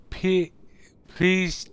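ordinary delivery

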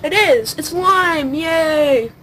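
loud happy cheer; excited. Real, not acted. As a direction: cheer at getting it right. The guess turns out right.

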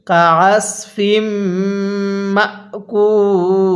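slow chant, Makul held very long; correct recitation. Shown as a demonstration. Teacher reciting the correct ending slowly. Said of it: "এরকমভাবে শিখব".

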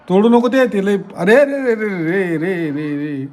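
high, loud cry stretching the re re re; alarmed shout. Real, not acted. Brother yelling at Shivani not to break it, alarmed shout. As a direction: yelp to stop her breaking it.

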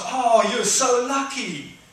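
high, loud, slow envious drawl; envious remark. Retold or imitated. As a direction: draw it out with envy. Prisoners telling Barabbas.

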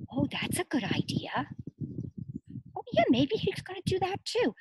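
ordinary talk, nothing marked

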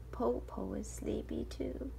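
slow drowsy put-on granny; sleepy wolf granny. Wolf pretending to be sleepy to the girls. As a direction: fake a yawny grandmother.

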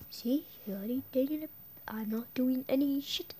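fast unvoiced whisper; sneaky whispered warning; menacing whisper. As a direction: hiss it secretly. Whispered threat.

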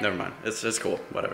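low, soft resigned repeat; deflated. Real, not acted. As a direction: shrug it off quietly.

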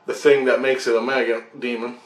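ordinary delivery

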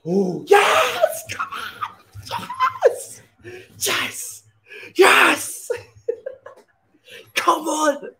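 high, loud triumphant yells with laughter; celebrating a huge win with laughing shouts; ecstatic celebration. Real, not acted. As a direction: roar with relief and joy.

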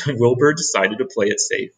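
ordinary delivery, nothing marked

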